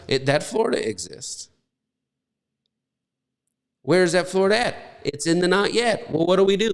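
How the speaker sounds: ordinary talk, nothing marked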